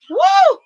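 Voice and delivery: high, loud celebratory woo; joyful whoop. Real, not acted. Host whooping over her winners on the live. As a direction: let out a shout of pure joy.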